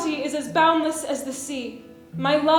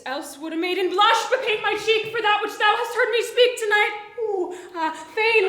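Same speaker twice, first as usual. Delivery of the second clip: laughing; 6 semitones higher than usual. Acted. Juliet blushing, laughing through lines.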